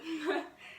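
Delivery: a quick amused laugh; short laugh. Real, not acted. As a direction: laugh at your own joke. Laughs at own joke.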